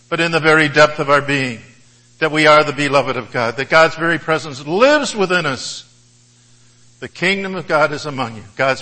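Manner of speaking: fervent sermon climax; impassioned preaching. Real, not acted. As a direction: drive the point home from the pulpit. Preaching at full force.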